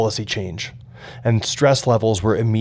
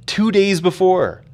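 higher, slow, drawn-out disbelief; exasperated disbelief, pitched up and stretched; incredulous repeat; 8 semitones higher, slower than usual. A narrator's reading. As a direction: repeat it like you can't believe the timing. Repeats it, incredulous at bad timing.

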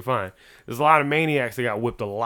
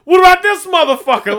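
high, very loud mock family yell; imitated screaming; 12 dB louder than usual. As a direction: scream it like a relative mid-argument, imitating. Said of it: "screaming, it'd be like".

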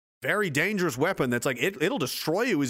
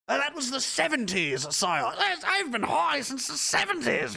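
mock servant voice; 10 semitones higher than usual. Servant and lord dialogue.

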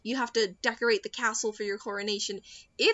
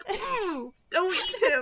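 high, whimpering, half-laughing plea; mock pleading; 8 semitones higher, slower than usual. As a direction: beg like you're about to cry, laughing. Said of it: "I'm like".

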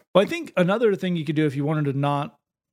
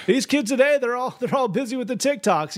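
pastor riffing; 8 semitones higher than usual. Pretend. Improvising as out-of-touch pastor.

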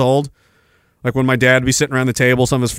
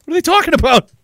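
put-on much higher, baffled kid voice; 12 semitones higher, faster than usual. Kid overhearing dad's friends, high and baffled. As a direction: squeak it out like a lost thirteen-year-old. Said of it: "I'd be like".

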